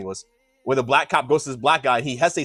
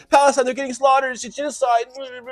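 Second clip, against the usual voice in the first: high, sneering mock voice; mocking parody; 12 semitones higher, 3 dB louder than usual. To opposing side in debate, high sneering mock of their slogans. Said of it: "Your whole guise is".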